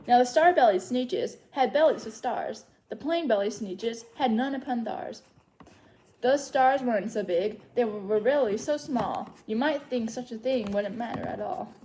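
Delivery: lively singsong read-aloud of a rhyming book; story reading to children. Acted. Librarian reads Seuss rhymes to little story timers, lively singsong. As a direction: read the rhymes to the kids with bounce.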